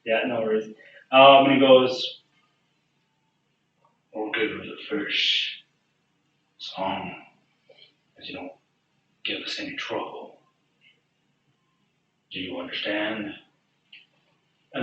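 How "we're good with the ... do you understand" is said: low-key, deliberate menace; quiet threat. Acted. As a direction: spell out the warning softly, with long pauses. Bartender quietly warns the party.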